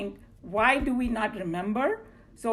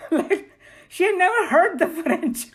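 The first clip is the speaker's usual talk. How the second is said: laughing; 8 semitones higher, 6 dB louder than usual. Real, not acted. Amused at doctors' excuse.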